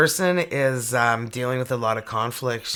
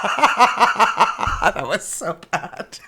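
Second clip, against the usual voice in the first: high, loud words through laughter; laughing hard; 7 semitones higher, 7 dB louder, much slower than usual. Real, not acted. Reader owning up to a bad joke, laughing hard. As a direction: squeeze the words out slowly while cracking up.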